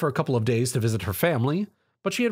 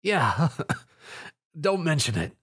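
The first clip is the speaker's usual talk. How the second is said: breathless wheezy line with a weak laugh; wheezing; 4 semitones higher than usual. A narrator's reading. Tom wheezes to Rainbow after the fight. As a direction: wheeze it out, hurt. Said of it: "Tom wheezed".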